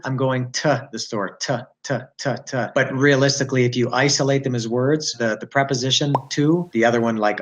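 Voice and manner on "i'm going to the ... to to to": "to" shortened to "tuh"; reduced TO. Shown as a demonstration. Teacher showing the preposition in a sentence, reduced TO. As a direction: make "to" a quick unstressed "tuh". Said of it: "about the preposition to".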